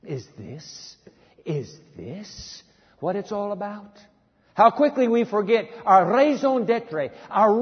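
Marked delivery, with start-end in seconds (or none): 0.0-4.0 soft, slow, wondering youth voice; softer questioning young voice; doubting young churchgoer. Retold or imitated. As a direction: let the question hang, hushed and unsure. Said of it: "the young growing up in the church say".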